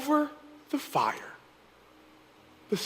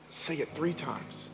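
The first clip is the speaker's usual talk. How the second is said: soft altar appeal; 4 semitones lower, 8 dB quieter than usual. Acted. Preacher closing over music, soft and intimate. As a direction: speak gently to each listener.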